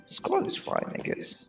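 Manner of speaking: usual speaking voice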